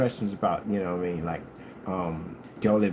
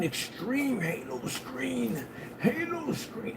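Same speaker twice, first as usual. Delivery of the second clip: higher, softer, drawn-out gush; 13 semitones higher, 8 dB quieter, much slower than usual. Real, not acted. Telling viewers about his Halo screen, dreamy gush. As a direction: croon the name like a treasured thing.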